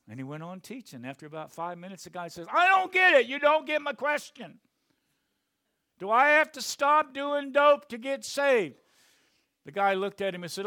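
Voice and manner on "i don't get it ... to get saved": high, loud yell from the crowd; stoned heckler yelling. Retold or imitated. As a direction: shout it up at the stage. Heckler yells a third time.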